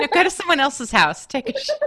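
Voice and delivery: said through real laughter, high and loud; laughing. Real, not acted. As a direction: laugh out the joke. Joking reply while laughing.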